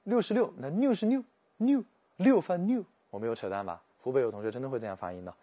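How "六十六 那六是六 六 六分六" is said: put-on high, loud Hubei accent with the n and l mixed up. Imitating a Hubei classmate saying "六十六" in his Hubei accent, high and loud. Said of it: "湖北有同学跟我讲".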